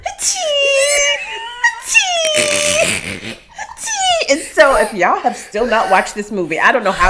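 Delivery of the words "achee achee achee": mock sobbing. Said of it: "it was".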